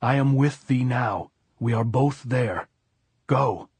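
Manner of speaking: firm, restrained shout; shouted reassurance. A narrator's reading. Robert Jordan sends Maria away up the draw, firm mild shout. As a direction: call out firmly, stopping short of a yell. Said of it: "Robert Jordan shouted".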